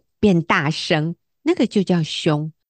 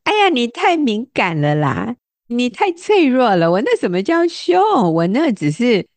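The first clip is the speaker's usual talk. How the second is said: dismissive brush-off; 6 semitones higher than usual. Retold or imitated. A harsh talker brushing off a listener, dismissive. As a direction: wave off the complaint as oversensitive. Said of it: "或者我们会觉得".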